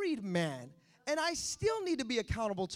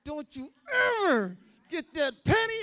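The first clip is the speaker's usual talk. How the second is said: angry mom scolding; 6 semitones higher, 11 dB louder than usual. Retold or imitated. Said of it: "I got the whooping of a lifetime".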